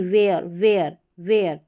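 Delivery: वेयर repeated low and falling, like where; right reading, repeated. Shown as a demonstration. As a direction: drill the correct वेयर. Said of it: "वियर नहीं".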